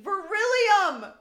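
drawn-out, high-pitched yell; excited shout. Real, not acted. Host seizing on friend's guessed element, excited shout. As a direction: belt the word out, slow and very loud.

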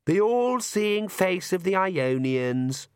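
high, drawn-out weary sigh of a line; theatrical weariness. A narrator's reading. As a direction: recite it slowly with theatrical boredom. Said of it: "a sigh of theatrical weariness".

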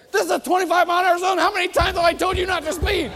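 high, loud, fast scolding; hammed-up furious cop tirade; ranting cop. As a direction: bawl out a speeder. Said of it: "Does a cop come up say".

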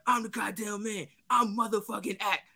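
hammed-up retold meltdown, building to a yell; going ballistic.

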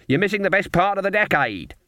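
high-pitched, bright wake-up call; unusually cheerful. A narrator's reading. Granny wakes the sleeping girl, unusually cheerful. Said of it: "in an unusually cheerful voice".

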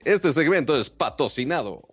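booming sponsor announcement voice; announcer voice. Shown as a demonstration. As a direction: read it like a radio ad. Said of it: "Ya para hacer la voz de".

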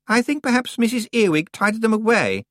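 put-on high, loud, complaining girl's voice; whiny young witch. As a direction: whine like a spoiled out-of-depth girl. Anagramma voice.

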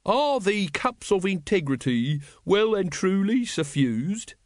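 slow, high ritual intonation; intoning. A narrator's reading. Lodge brother putting the ritual question, intoning. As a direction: chant it out ceremoniously, drawn-out. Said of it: "intoned Brother Watchtower".

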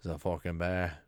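low Aussie deadpan; mock Australian accent. Spotting a bear, low mock Australian accent.

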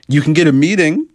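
mock promise. Real, not acted. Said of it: "I'm joking".

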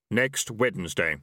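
unhurried Death, every word weighed; grim reaper voice. A narrator's reading.

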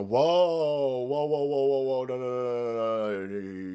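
drawn-out whoas sliding steadily downward; mock alarmed objection. Prosecutors cutting off the defiant candidate, mock alarmed objection. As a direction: run the protests together in one long descending stream.

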